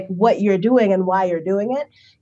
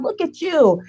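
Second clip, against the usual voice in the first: mock gushing; 9 semitones higher, slower than usual. Strangers cheering her run.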